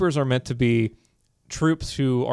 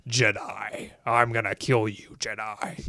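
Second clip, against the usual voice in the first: hammed-up menace, pitched down; menacing villain; 3 semitones lower, 4 dB quieter, slower than usual. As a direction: growl a generic bad-guy threat. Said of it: "like, menacing voice".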